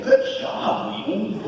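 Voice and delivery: high, bright cheer to himself; mock self-praise. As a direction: cheer yourself on like a proud coach. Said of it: "people will hear me say".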